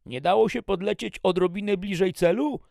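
ordinary delivery, nothing marked

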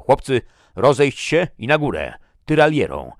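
high, very loud command to the troops; captain rallying his men at full voice; shouted order. A narrator's reading. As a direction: call it out across the column.

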